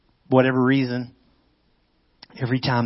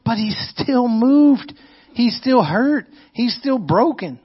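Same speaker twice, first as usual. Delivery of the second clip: pained; 8 semitones higher, slower than usual. Real, not acted. Preacher stressing Jesus still hurt.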